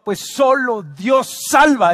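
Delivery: emphatic but short of shouting; firm proclamation. Real, not acted. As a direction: declare it with pulpit conviction. First proclamation of key phrase.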